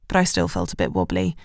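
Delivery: ordinary delivery, nothing marked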